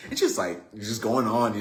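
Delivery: normal talking voice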